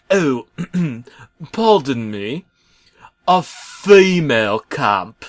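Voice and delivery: high, slow fussy correction, clearing the throat first; prim self-correction, taking it slow; correcting himself. Acted. Bob correcting himself to the trucks, in a slow prim high voice. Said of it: "correcting himself".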